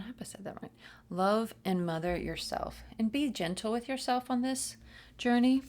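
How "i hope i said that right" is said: soft unvoiced aside; whispered aside. Real, not acted. Unsure aside.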